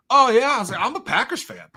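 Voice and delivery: high, loud burst of eagerness; mock eager. Shoveller trying to bond with the Wisconsin neighbour, overeager. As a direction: jump in overkeen to bond.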